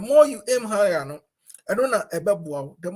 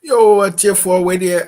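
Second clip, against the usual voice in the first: booming hail to the listeners, much louder; loud call-out; 12 dB louder than usual. Real, not acted. Host hailing his radio listeners, loud call. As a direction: call out to the audience with full voice.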